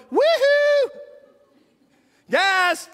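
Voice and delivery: very high, loud yelp calling the others. Retold or imitated. Officer finding the woman calls partners, high loud yelp. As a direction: shout for help down the hall.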